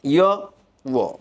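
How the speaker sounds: ইয় as a Y glide, ও as a rounded W; Y and W sounds. Shown as a demonstration. Teacher introducing Y and W, isolated sound demo. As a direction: isolate ইয়, pause, then ও. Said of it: "ওয়াইয়ের উচ্চারণ ইয় এর মতো হয়".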